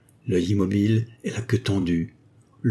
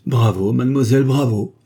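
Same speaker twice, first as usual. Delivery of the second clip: louder, delighted cry of praise; enthusiastic cry; 4 semitones higher, 6 dB louder than usual. A narrator's reading. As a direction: cheer her on with gusto. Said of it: "s'écria l'inflammable gentilhomme".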